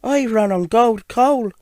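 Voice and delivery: high little engine voice; high storybook engine character; gold engine. Acted. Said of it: "she said".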